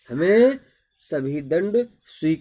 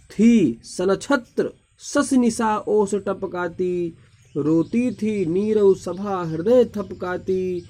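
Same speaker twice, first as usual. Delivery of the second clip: dramatic poem recitation; 3 semitones higher, 3 dB louder than usual. Acted. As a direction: proclaim each line as if to a royal court. Teacher recites Kaikeyi's verse.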